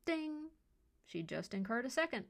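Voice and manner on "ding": high, ringing voiced "ding"; phone notification ding. A narrator's reading. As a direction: imitate a phone alert chime. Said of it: "Leah's cell dinged with a flurry".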